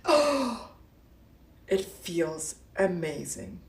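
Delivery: breathy whispered gasp then soft slow line; hushed awe; awed whisper. Pretend. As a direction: whisper it in wonder. Awed whisper after affirmations.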